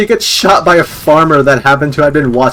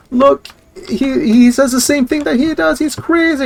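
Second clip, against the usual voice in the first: high, frantic mock rant climbing higher; hysterical mom; 11 semitones higher than usual. Mom insisting her kid is possessed, hysterical. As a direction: wail it like a desperate, unbelieved mother. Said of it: "this mom going".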